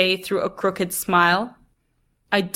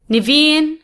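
high raised call from another room; 8 semitones higher, 6 dB louder than usual. A narrator's reading. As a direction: call the name across the house. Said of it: "the soft voice calls again".

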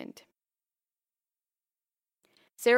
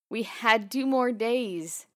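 slow, higher, louder burst of grief; a wife blurting out her grief over the dog, higher and louder; blurted grief; 5 semitones higher, 6 dB louder, slower than usual. A narrator's reading. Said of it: "she blurted".

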